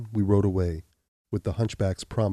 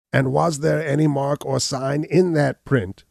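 slow, higher, louder challenge; 6 semitones higher, 6 dB louder than usual. Acted. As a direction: lean on each word, daring him. Gall challenges Abner.

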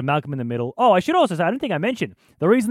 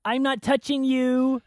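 put-on high, slow taunting boy; Malcolm impression; 11 semitones higher, slower than usual. Host shows his Malcolm taunting a brother, high and slow. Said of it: "That's my Malcolm".

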